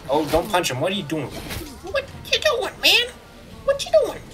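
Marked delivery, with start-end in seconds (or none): 1.3-4.2 very high squeaky voice; playful squeal of protest; squeaky mock protest. Player protesting to a game enemy for punching, squeaky mock protest.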